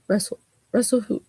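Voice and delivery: ordinary talk, nothing marked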